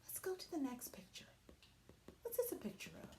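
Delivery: quick whispered prompts. Real, not acted. Teacher moving to the next picture, quick whisper. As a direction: hurry on in a whisper.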